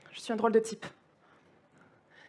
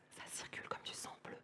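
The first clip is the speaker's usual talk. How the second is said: barely audible, voiceless whisper; whispered refrain; 22 dB quieter than usual. Acted. Poet repeating the blue-blood refrain to listeners, in a whisper. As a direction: whisper it like an echo.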